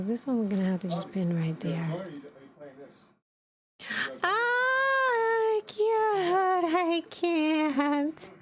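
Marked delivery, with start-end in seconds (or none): 4.2-8.3 long high squeal of delight; cuteness squeal. Real, not acted. Streamer overwhelmed by a cute model, squealing. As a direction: squeal it out, melting into laughter.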